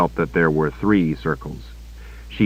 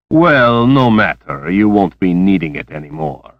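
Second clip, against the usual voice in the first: menacing villain; 6 dB louder than usual. Acted. Villain taunting the captive.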